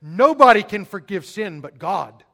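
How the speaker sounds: objecting Pharisees. Retold or imitated. Preacher voicing the Pharisees balking at Jesus, loud mock protest. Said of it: "They're like".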